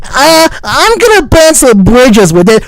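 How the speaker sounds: high, mocking parody of an orator; mocking imitation. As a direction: mimic him with loud contempt. Mockingly echoes the played clip.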